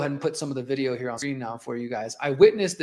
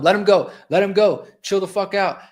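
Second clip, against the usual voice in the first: raised-voice retold demand, pitched up; 4 semitones higher, 6 dB louder than usual. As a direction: act out ordering the cops off, short of screaming. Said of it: "I'm telling them".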